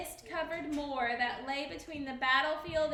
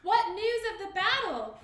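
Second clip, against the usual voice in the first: urgent raised call; crying out; 6 semitones higher, 7 dB louder than usual. A narrator's reading. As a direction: call out for news. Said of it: "cried Prince Malcolm".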